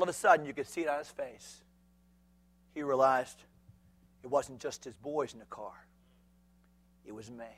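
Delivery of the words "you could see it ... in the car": normal talking voice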